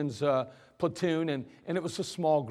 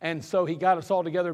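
usual speaking voice

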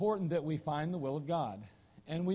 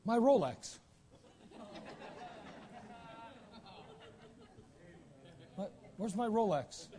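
panicked lawyer; 8 semitones higher than usual. Acted. Maimed lawyer cries for his watch.

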